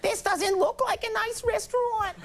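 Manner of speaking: very high falsetto mother caricature; hammed-up maternal complaint, way up high; imitated complaining mom. Comedian voices mom doubting the restaurant, complaining falsetto.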